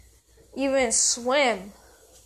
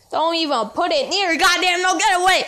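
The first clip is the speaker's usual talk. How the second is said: shouting; 4 semitones higher, 5 dB louder, faster than usual. Acted. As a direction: yell it louder and higher, push him away. Yelling at feather nose.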